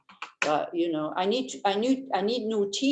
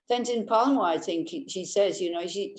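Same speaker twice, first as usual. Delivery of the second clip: usual speaking voice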